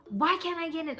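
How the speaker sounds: a pissed-off ghost demanding to get inside; retold angry yell. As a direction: imitate an angry ghost lady yelling. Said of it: "yelling, and she was pissed off".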